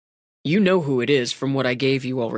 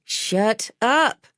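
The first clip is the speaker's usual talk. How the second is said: lightly voiced bossy woman, higher; exasperated interruption; 7 semitones higher than usual. A narrator's reading. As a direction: cut in on the patient's protest. Medic interrupts protesting patient.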